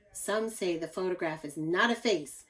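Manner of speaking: ordinary delivery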